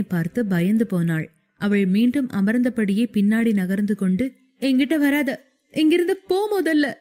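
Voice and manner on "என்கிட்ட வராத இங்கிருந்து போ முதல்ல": high tearful plea, pushing him away; crying. A narrator's reading. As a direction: cry it out, higher and higher. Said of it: "என்று அழுதாள்". (அழுகையுடன் உயர்ந்த குரல்)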